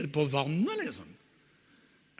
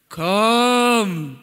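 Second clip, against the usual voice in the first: loud commanding kom; Jesus calls; 6 semitones higher, 9 dB louder than usual. Acted. Jesus calling Peter onto the water, loud. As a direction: call him out across the water. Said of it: "Og så sier Jesus".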